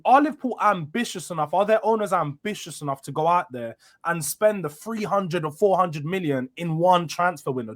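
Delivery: normal talking voice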